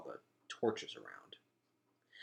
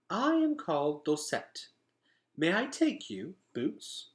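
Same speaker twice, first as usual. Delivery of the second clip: put-on a gentle fifteen-year-old offering help; young woman; 9 semitones higher than usual. A narrator's reading. Dosette kindly offering to take Boots for a bath.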